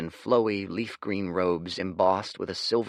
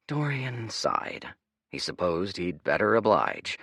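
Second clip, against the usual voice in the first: usual speaking voice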